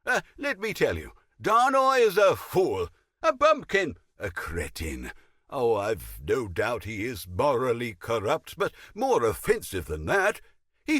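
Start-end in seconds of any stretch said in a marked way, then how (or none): 1.4-5.1 scornful insult. Acted. Insulting the admiral.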